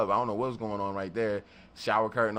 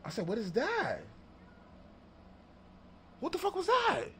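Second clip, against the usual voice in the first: higher and higher startled outburst; startled, rising; 12 semitones higher, 7 dB quieter than usual. Retold or imitated. Narrator startled by a knock at his studio door. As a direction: react to a pounding knock.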